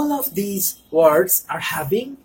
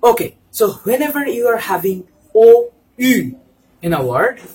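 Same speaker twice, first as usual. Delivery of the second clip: ordinary talk, nothing marked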